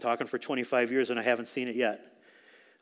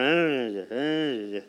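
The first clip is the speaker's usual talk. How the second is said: imitating a praying drone; much slower than usual. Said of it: "like this, over and over".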